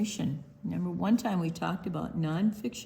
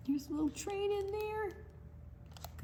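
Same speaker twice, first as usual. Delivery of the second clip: excited aside; 14 semitones higher, 8 dB quieter than usual. Real, not acted. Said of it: "reading to children aside".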